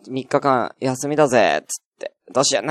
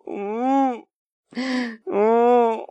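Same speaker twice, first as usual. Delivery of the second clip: tearful; 10 semitones higher, 4 dB quieter, much slower than usual. Real, not acted. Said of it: "本当に、悲しい。".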